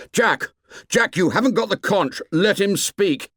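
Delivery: shouting. Acted. Ralph shouting over quarrel.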